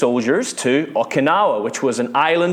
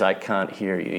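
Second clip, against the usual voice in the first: hushed plea; 5 semitones lower, 6 dB quieter than usual. Retold or imitated. Retelling the soldier's prayer.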